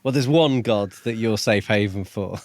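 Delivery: ordinary delivery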